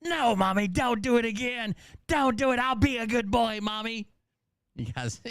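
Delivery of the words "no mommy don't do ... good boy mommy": put-on pleading child. Child begging abusive mother.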